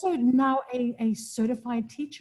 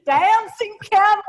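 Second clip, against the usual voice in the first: laughing; 10 semitones higher, 9 dB louder, slower than usual. Real, not acted. Laughing repeat of the name.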